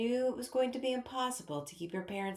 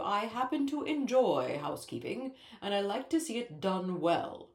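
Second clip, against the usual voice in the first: lecturing king. A narrator's reading. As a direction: lecture the child with royal authority. King voiced in story.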